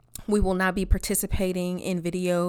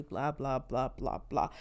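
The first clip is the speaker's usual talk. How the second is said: much softer and lower, waving it off; dismissive blah; 8 semitones lower, 8 dB quieter than usual. Real, not acted. Brushing off the conspiracy talk with a dismissive mumble. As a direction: trail off in a bored, dismissive mumble.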